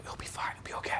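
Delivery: very soft hushed reassurance; quick secretive whisper. Retold or imitated. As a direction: reassure under your breath in a whisper. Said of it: "I'm still, like, whispering it".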